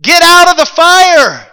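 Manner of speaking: full-force high yell with drawn-out words. Acted. King yells into the roaring furnace, loud and high. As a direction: holler it over a roaring fire. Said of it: "he is yelling".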